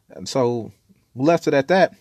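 usual speaking voice

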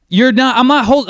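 high, loud mock protest; retold indignant protest. Retold argument with the driver.

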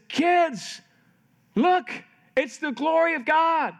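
high-pitched imitation of an excited mom; acted-out wife exclaiming over a sunset; excited calling out. Wife excitedly calls kids to the sunset.

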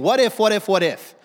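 emphatic. Shown as a demonstration. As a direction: throw "what if" out like a dramatic question. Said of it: "the whole idea of this phrase".

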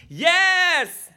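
high, loud, drawn-out cheer; imitated kids' scream. Preacher voices kids answering their teacher, gleeful mock scream. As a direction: yell it like a classroom of eager kids. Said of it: "They all screamed".

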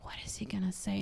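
whispered mock apprehension; anxious mom's whisper. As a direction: whisper it like a worried mom. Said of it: "his mom is like".

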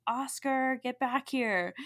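retold dog owner's yell; imitated yell. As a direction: call the dog back, half-yelled. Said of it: "the lady was yelling".

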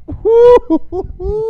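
high, slow howling cackle; howling laugh. Real, not acted. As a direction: throw your head back and howl. Laughing howl after punchline.

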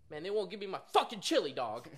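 high-pitched, exasperated customer rant; imitated pissed-off customer. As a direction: gripe like a customer who's been wronged. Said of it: "he's all pissed".

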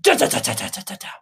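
rapid stuttered rattle imitating a shaking plane; juddering noise. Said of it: "the whole thing went".

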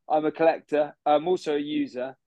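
ordinary talk

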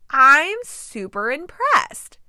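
slow, drawn-out mock praise; sarcastic. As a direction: drip sarcasm, stretching it out. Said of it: "once again, that's not happening".

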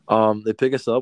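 ordinary talk, nothing marked

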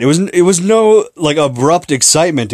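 ordinary talk

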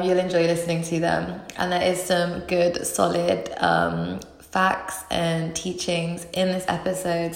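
ordinary talk

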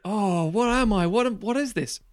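high, wailing dismay; mock-panicked plea, pitch climbing; hammed-up horror at what you have become; acted bewildered distress. Acting man horrified at his body.

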